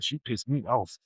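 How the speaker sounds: ordinary talk, nothing marked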